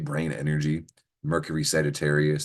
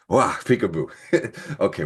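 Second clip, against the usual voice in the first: ordinary delivery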